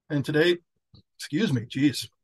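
burp breaking into the words. Real, not acted. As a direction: talk through a fizzy burp. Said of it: "Sorry, I'm drinking Doctor Pepper. I'm burping".

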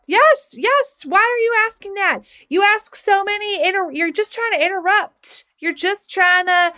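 high, impatient teacher telling off a pupil; worked-up mock telling-off; exasperated scolding. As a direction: scold an interrupting child, pitched well up. Teacher scolding her, retold.

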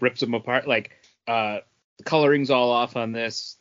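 usual speaking voice

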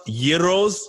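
y in place of the g on "gyros"; "gyros" pronounced YEE-ros, offered as the correct way. Shown as a demonstration. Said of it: "I think they're pronounced gyros".

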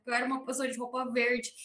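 ordinary delivery

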